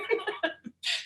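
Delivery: quick unvoiced giggle; soft breathy laugh. Real, not acted. Laughing at her own driving story, soft giggle. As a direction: let a breathy laugh slip out.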